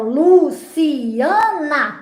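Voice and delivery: "Luciana" slowly as Lu-ci-a-na; drawn-out, singsong "Luciana" in four beats; syllable by syllable. Shown as a demonstration. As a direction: stretch each syllable of "Luciana" for counting. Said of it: "Quantos pedacinhos tem o, o seu nome?".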